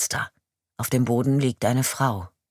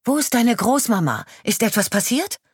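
calling out; 11 semitones higher, 5 dB louder than usual. Acted. Calling to the approaching girl.

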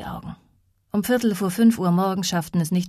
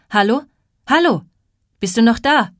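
loud, raised-pitch calls into the phone; calling out; 6 semitones higher, 8 dB louder than usual. A narrator's reading. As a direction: call louder and higher to reach a silent caller. Dispatcher calls into silent line. (laut und eindringlich ins Telefon rufen)

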